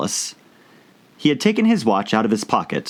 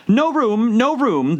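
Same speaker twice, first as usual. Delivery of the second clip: crying out; slower than usual. Acted. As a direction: shoo her off with a high cry. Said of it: "they cried out".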